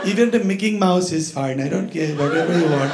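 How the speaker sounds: put-on polite kid's Indian accent; young boy, thick Indian accent; childhood Indian accent. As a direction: plead for cartoons in an Indian accent. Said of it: "That was my accent".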